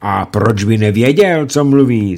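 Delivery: loud, high cunning question; sly question. Acted. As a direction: ask it slyly, laying a trap. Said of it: "zeptal se rychtář úskočně".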